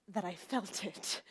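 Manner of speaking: slow, hushed and tender; soft and tender. Real, not acted. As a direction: let it fall soft and slow. Moment of maternal love.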